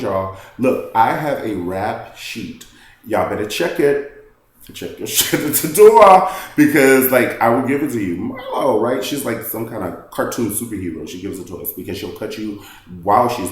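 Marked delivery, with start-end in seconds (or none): 0.6-8.2 rising into a loud, laughing threat; mock warning. Marlo warning the other women, building to a shout. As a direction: play it up like a tough warning.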